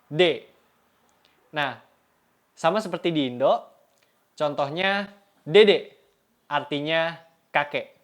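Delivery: usual speaking voice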